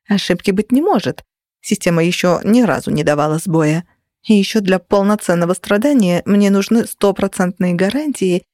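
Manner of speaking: ordinary delivery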